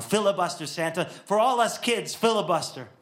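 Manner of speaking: put-on eager child chanting filibuster; pleading cheer. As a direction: urge Santa on like a hopeful kid.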